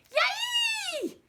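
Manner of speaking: put-on high, loud kid's yelp of joy; gleeful holler. Little brother hollering yay as he runs off. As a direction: whoop it out like a thrilled little boy. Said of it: "Joji suddenly hollered".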